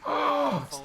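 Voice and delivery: high, sharp intake of breath; startled gasp. Real, not acted. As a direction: gasp in sudden shock. Startled by a find in game.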